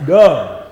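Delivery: "Dumb" punched loud and falling, said alone; self-mocking emphasis. Real, not acted. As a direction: slam the word "Dumb" down hard. Preacher mocks himself.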